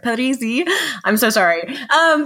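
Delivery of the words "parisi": Parisi in a put-on Italian accent, higher pitched; attempted Italian pronunciation of Parisi. Shown as a demonstration. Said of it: "say it with the Italian accent".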